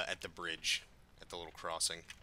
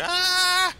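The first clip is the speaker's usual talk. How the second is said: very high, loud yelp; sudden scream; 16 dB louder than usual. Real, not acted. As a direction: scream out, cutting yourself off mid-thought. Cries out mid-sentence during play.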